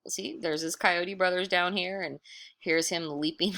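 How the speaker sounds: ordinary delivery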